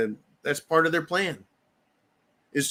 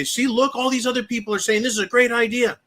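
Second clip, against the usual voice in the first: high, fast pushy pitch; lightly voiced union leader egging them on; a hint of a smug organizer; mock agitator; 7 semitones higher than usual. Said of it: "the leader will say".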